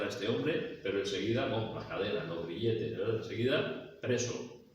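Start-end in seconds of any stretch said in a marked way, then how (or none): none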